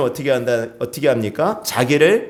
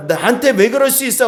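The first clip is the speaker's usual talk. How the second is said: higher-pitched, mildly indignant protest; mock indignation; 7 semitones higher than usual. Preacher voicing someone angered by criticism, mock indignant. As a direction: act out a touch of wounded outrage. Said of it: "하고 분노한다라는 것은요".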